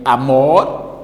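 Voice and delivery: amor drawn out, with a loud MOR; stress on MOR in amor. Shown as a demonstration. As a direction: stretch and push the last syllable of amor. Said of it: "pronunciando amor".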